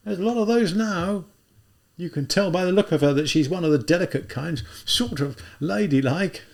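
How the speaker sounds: put-on an older policeman clucking over the girl; policeman character.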